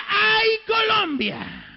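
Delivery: long, high, loud cry; preacher's drawn-out retold cry; retold anguished call. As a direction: call it out and stretch it. Retold cry of the Lord in vision.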